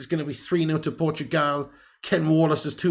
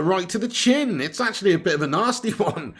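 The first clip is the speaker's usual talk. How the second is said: ordinary talk, nothing marked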